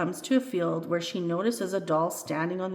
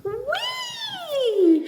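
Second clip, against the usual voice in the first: very high, long whee; high, gleeful shout; joyful shout; 5 dB louder than usual. A narrator's reading. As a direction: squeal like flying through the air. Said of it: "shouts the little spir-, deer spirit doll".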